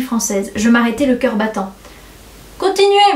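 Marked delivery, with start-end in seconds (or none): 2.6-3.2 high, raised-voice call to go on; appel encourageant. A narrator's reading. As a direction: call out to a pupil. Président encourage la candidate en lecture. (appel aigu, voix haussée)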